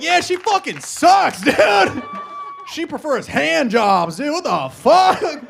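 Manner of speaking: loud, high, drawn-out yelling; mock shouted rant. Comic rants about his girlfriend in the audience, mock shouted rant. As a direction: yell it slowly in mock outrage.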